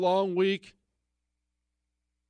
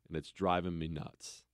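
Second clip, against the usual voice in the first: ordinary talk, nothing marked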